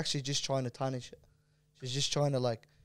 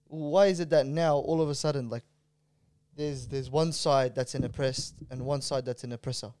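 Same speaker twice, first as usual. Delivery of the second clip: ordinary talk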